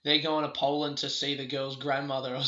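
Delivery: ordinary delivery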